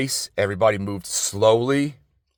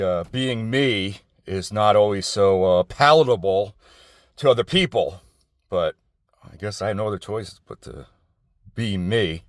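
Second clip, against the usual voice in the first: ordinary talk, nothing marked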